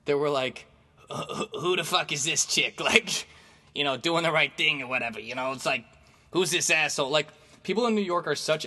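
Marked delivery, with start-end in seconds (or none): 1.1-7.1 lightly put-on scornful New Yorker; mock the onlookers, pitched a little up; judgmental onlookers. New Yorkers eyeing the girl who helped, judgmental. Onlookers judging the good deed.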